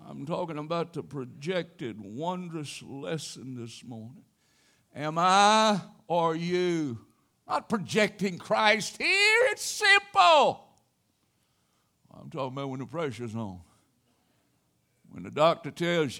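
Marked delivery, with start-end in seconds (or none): 4.9-10.7 slow, loud, rising preaching; preaching emphasis. Real, not acted. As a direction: drive the point home slowly.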